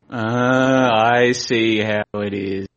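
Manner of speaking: slow, resigned sulky drawl; mock sulky acceptance. Retelling being ignored by Rollo, mock wounded resignation. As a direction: play it hurt but pretending to be fine. Acting out sulky reaction to silence.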